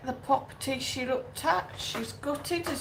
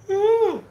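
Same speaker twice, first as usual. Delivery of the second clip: a big open yawn sound; loud yawn; 8 semitones higher, 9 dB louder than usual. Real, not acted. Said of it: "yawning me head off".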